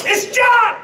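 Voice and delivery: high, loud name shout; excited shout. Retold or imitated. John shouting his name to the dad on the phone. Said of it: "John Pignette".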